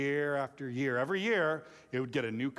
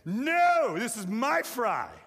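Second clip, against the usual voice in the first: loud, high mock-kid protest, slowing on the end; possessive kid refusal; 8 semitones higher, 6 dB louder, much slower than usual. Dad acts out a kid guarding fries, loud high protest. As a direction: act out a child refusing to share, pitched way up.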